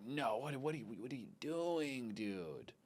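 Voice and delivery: very soft, disbelieving aside; dismayed muttering. Retold or imitated. Recalling his thoughts in bed, quiet dismayed muttering.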